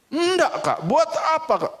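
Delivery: put-on high, cheeky kid pushing back; childish defiance in a high voice; defiant child. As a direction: stand up to big sister. Boy defies sister.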